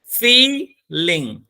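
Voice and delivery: "Feeling" broken into "fee", pause, "ling"; split into syllables. Shown as a demonstration. Teacher showing students the two syllables, "Feeling" split fee-ling. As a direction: draw out "fee", then a separate "ling". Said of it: "how will you pronounce it?".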